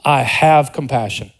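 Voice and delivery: "have" leaned on, slow and deliberate; stress on have. Shown as a demonstration. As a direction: linger on "have" in "I have compassion". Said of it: "says about himself".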